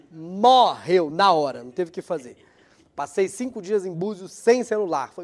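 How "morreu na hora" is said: ordinary talk, nothing marked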